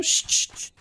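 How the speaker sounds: voiceless "chut" hush; comic hush before listening; playful shushing. Pretend. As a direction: shush for silence, just air. Hushes before vendor speaks.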